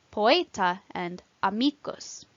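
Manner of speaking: "poeta" and "amicus" slowly, stressed on E and MI; penultimate stress. Shown as a demonstration. Teacher showing long-vowel rule, "poeta" and "amicus" stressed second-last. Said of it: "second syllable from the end is stressed".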